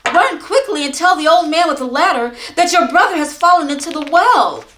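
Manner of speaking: high, loud, frantic cry; a mother panicking for her son; alarmed mother. Acted. Mother sending her son for help, frantic and high. Mother gasps at terrible news.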